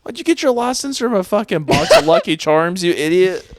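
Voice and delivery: high, loud insult at a driver; road rage yelling. Retold or imitated. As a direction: yell it out the car window. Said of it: "I still say that when I'm driving".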